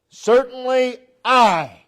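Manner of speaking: Certainly I, with I drawn out slow and loud; stress on I. Shown as a demonstration. Preacher stressing God's promise, I held long and loud. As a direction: lean hard on I in "Certainly I". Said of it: "there's the emphasis".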